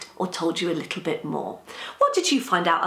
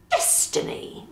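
high, breath-edged pronouncement; announcing; 3 dB quieter, slower than usual. A narrator's reading. Nan gives Easter a crossword answer, announcing it. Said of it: "Nan announced".

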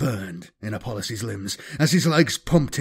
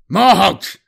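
Morholt! shouted higher and louder, snarled; 6 semitones higher, 7 dB louder than usual. Acted. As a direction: snap out Morholt! as an angry call. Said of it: "Apollos snarled".

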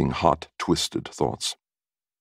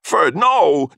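high, loud, horrified shout; alarmed shouting, pitched way up; yelling; 13 semitones higher, 6 dB louder than usual. A narrator's reading. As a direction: yell to stop him wrecking it. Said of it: "Oscar yelled".

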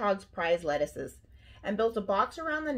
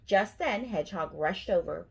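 plain reading voice

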